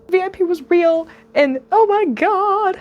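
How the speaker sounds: real near-tearful joy; overwhelmed joy. Real, not acted. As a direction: let the emotion crack through. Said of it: "I don't wanna cry".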